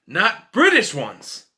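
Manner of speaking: ordinary talk, nothing marked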